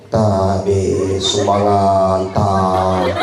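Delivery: low, dragged-out plea for donations; imitating an odd door-to-door collector; strange droning speech. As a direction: drone it slowly on a single low note. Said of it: "Kenapa dah begitu caranya salam, bicara?".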